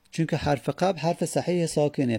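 ordinary talk

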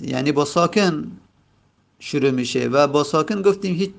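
normal talking voice

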